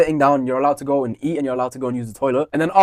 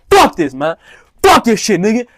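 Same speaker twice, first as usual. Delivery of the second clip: loud outburst, rising in pitch; retold angry swearing; 7 semitones higher, 5 dB louder than usual. Pupil swearing at the teacher, angry re-enacted yell. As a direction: re-enact a teenager cursing out a teacher, punched hard. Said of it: "I was swearing at the teacher".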